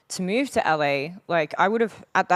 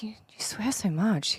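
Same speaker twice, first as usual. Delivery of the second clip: slow, scandalised whisper; mock shocked whisper; 5 semitones higher, slower than usual. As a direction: whisper it as if the swearing shocks you. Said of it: "they're just like".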